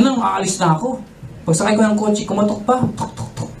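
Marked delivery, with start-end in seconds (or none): 3.0-3.5 soft knocking sound. Retold or imitated. Imitating knocking on car.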